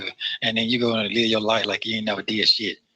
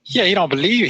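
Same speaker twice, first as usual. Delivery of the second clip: indignant outburst; 8 semitones higher, 4 dB louder than usual. Real, not acted. Outraged about call restrictions.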